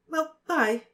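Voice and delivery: put-on high girl's voice, quick goodbye; flustered Cho. A narrator's reading. Cho leaving Harry, high and flustered. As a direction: say bye high and hurried. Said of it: "said Cho, still very red".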